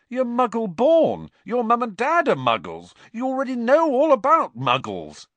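loud, very high-pitched disbelief; exasperated protest. A narrator's reading. Ron objecting to Hermione's Muggle studies, exasperated. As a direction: argue it incredulously, voice shooting up.

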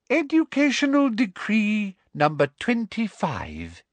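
high, precious proclamation; officious reading. A narrator's reading. Umbridge reading her new decree aloud, officious. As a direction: announce it high and self-important.

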